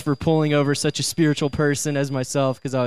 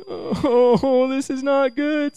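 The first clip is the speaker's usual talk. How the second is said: much higher, slowed hammed-up dread; mock dismay; 10 semitones higher, much slower than usual. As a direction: ham up the sinking feeling. Police lights behind him.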